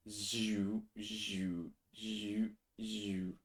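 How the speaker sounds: whispered zoo zoo gate noise; whispered whoosh. Retold or imitated. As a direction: breathe the sliding gate sound. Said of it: "the way the gate moves".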